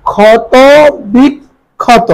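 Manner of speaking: ক্ষত বিক্ষত in pieces, "khoto... bik... khoto"; syllable-by-syllable pronunciation. Shown as a demonstration. Teacher repeats for students, ক্ষত বিক্ষত broken into parts. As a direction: pause between each part of ক্ষত বিক্ষত. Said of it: "এই শব্দ উচ্চারণ করি".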